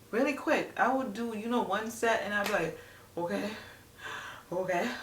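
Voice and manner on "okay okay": imitating winded "okay"s; out of breath. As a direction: say it between puffs, as if catching breath. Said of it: "just so out of breath".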